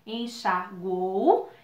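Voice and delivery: "Enxaguou" bem lento, en-xa-guou, com o "uou" junto; palavra soletrada em sílabas. Shown as a demonstration. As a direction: separe "Enxaguou" devagar, sem partir o "uou". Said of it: "na última sílaba". ("Enxaguou" silabado para a turma)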